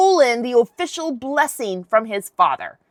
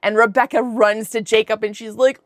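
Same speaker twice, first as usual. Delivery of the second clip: ordinary delivery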